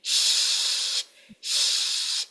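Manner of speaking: ش blown out as a long unvoiced hiss; ش as a steady airflow, no voice; breathy sh. Shown as a demonstration. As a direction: push breath through ش with no voice. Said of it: "سانس کے ذریعے".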